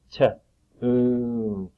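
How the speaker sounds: "T-oon" split, long oo drawn out; sounded out word. Shown as a demonstration. Teacher isolates the long U for children, sounding it out. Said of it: "That's got the long U sound".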